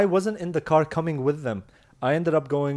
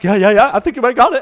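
laughing; 8 semitones higher, 11 dB louder, faster than usual. Real, not acted. Laughing encouragement at a lock.

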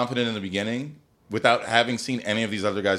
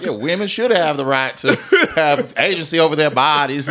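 imitated Southern accent; 4 semitones higher, 6 dB louder than usual. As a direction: declare it in a broad put-on Southern accent. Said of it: "The most southern accent I've ever heard".

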